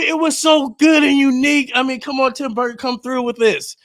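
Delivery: high-pitched, excited fan rave; gushing defense. Real, not acted. Host defends a beloved movie.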